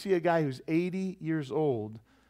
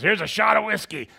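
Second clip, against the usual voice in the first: higher, louder offer; pushy mom; 5 semitones higher, 4 dB louder than usual. Retold or imitated. Girlfriend's mom at bar, loud pushy offer. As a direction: push the shot on him. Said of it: "she goes".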